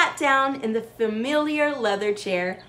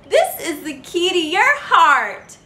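beaming gently; 6 semitones higher, 6 dB louder, slower than usual. A narrator's reading. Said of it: "she beamed gently".

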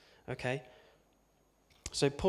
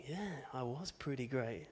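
softer, pleased little aside; smug self-satisfied aside; 9 dB quieter than usual. Retold or imitated. Imagined dead man pleased with his obituary, softer. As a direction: murmur it like you're flattered. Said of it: "you'd be like".

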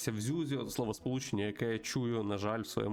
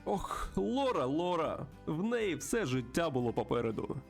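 raised, animated character voice; friendly bird host, pitched up; chatty old friend; 6 semitones higher than usual. Acted. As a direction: talk confidingly to a pal in a higher pitch. Plays friend character at saloon.

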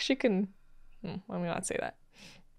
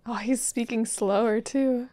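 usual speaking voice

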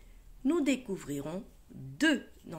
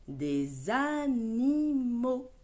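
animaux drawn out slowly; slow model reading; 2 semitones higher, much slower than usual. Shown as a demonstration. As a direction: read des animaux slowly for a child. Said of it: "models word slowly". (lecture lente de des animaux)

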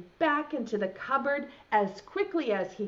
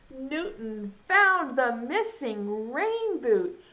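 usual speaking voice

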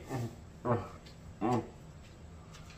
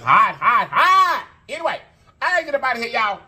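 excited; 10 semitones higher, 4 dB louder than usual. Real, not acted. Exclaiming about heat.

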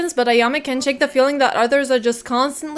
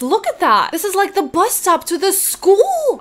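high-pitched astonished discovery; surprised, much higher voice; amazed; 7 semitones higher than usual. Real, not acted. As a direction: let the pitch leap in disbelief.

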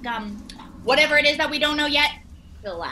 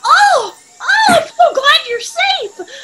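high, slow, crying with relief; tearful relief; 12 semitones higher, 9 dB louder, much slower than usual. Acted. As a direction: sob it out with joy.

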